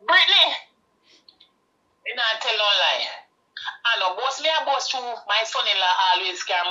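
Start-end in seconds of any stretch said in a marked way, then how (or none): 0.1-0.5 loud high yell of a name; calling out. Acted. Mother calling her daughter Brittany, loud high yell. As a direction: shout the name across the house.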